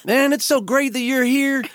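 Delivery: very high, slow syrupy greeting; hammed-up chummy old Texan; gushing Texas gentleman. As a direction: overdo the friendly schmooze, way up high. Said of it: "he goes".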